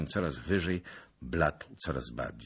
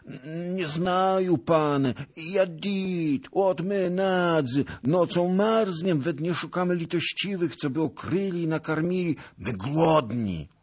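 put-on whining old beggar; 13 semitones higher, 5 dB louder than usual. Hungry beggar begs a lord for mercy, whining. Beggar pleads ignorance and hunger.

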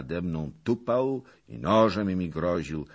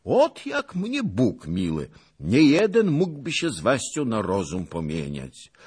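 high, loud voice of wonder; Longinus, exclaiming; 7 semitones higher, 6 dB louder than usual. A narrator's reading. Longinus admiring Zagłoba's wits, exclaiming. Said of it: "wykrzyknął pan Longinus".

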